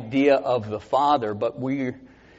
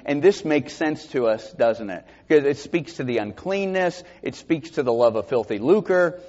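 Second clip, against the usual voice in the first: usual speaking voice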